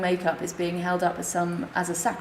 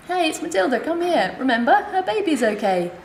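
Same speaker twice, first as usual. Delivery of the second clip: higher, laughing call to a colleague; excited calling over; 8 semitones higher, 4 dB louder than usual. Retold or imitated. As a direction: call the others over with delight.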